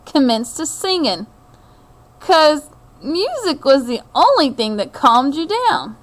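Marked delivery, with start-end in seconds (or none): none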